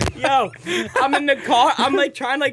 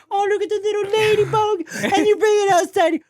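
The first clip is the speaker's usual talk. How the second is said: cooing over ladybug; 11 semitones higher than usual. Retold or imitated. Person saving a ladybug, high cooing. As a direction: coo at the little ladybug.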